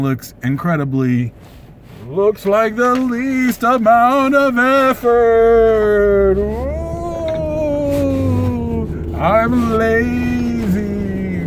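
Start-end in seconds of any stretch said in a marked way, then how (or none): 2.1-11.4 spooky voice. Pretend. Spooky voice returns for joke.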